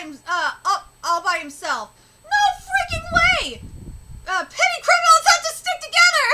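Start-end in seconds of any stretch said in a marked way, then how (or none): 2.2-6.3 high, loud excited cheer; thrilled shout. Real, not acted. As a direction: hype it up, laughing. Reacting loudly to dramatic scene.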